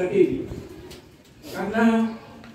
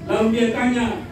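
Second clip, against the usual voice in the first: ordinary delivery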